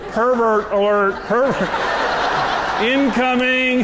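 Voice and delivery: mock alarm from wife. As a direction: sound the pervert alert like a playful siren. Wife's mocking thought, laughed.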